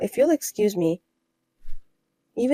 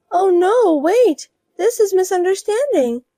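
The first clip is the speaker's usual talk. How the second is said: higher, louder flustered denial; higher voiced woman caught out over a rumor; panicked rival wife; 10 semitones higher, 6 dB louder, slower than usual. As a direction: scramble to deny it, higher.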